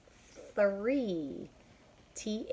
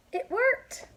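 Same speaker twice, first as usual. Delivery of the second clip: very high, loud cry of joy; very high joyful shout; delighted Elephant; 8 dB louder than usual. Acted. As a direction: gasp then cheer it.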